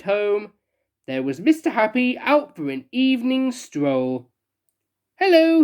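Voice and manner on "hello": high, loud cheery greeting; grinning. Acted. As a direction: greet with a big grin. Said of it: "he grinned".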